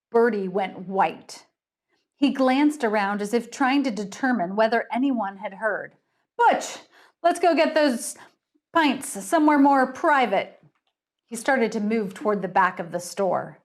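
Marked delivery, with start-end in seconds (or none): 6.4-10.6 nervous. Acted. Bertie panicked, hurrying away.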